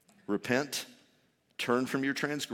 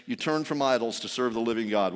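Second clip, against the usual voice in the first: usual speaking voice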